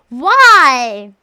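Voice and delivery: loud, drawn-out WHY; loud exclaimed why. Real, not acted. Streamer challenging a chat comment, loud exclamation.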